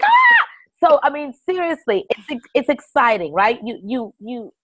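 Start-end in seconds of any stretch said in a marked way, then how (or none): 0.0-0.5 very high excited squeal; mock fangirl scream. Guest re-enacting hearing her name on TV, hammed-up fangirl scream. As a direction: let out a starstruck shriek.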